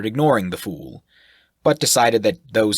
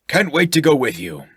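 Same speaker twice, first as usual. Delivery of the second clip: loud, higher, hearty; jovial sailor-type voice; 8 semitones higher, 6 dB louder than usual. Acted. Shirk flatters the sailor, loud and jovial. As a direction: boom it out like a jolly brute.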